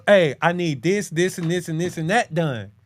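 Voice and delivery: ordinary talk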